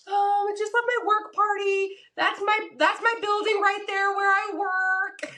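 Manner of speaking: high, slowed, flustered rambling; nervous over-explaining. Retold or imitated. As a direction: babble it up high, eager to please.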